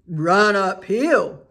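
loud, slow sheep call; slow loud sheep cry. A narrator's reading. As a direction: bleat it out slowly. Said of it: "cried the sheep".

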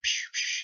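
breathy unvoiced gunshot puffs; mock gunfire; gun noises. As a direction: spit out two quick shot sounds. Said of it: "he's shooting a gun".

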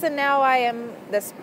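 ordinary talk, nothing marked

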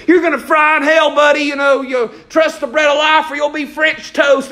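mocking the street preacher's shouting; mock street preacher yell. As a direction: ham up a hellfire street preacher. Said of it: "looking at people and saying".

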